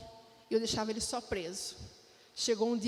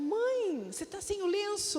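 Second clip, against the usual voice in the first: put-on high, surprised child's question; imitating daughter; 8 semitones higher than usual. Daughter seeing her mother bald at school, high surprise. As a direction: lift it up like a startled little girl. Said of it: "ela falou".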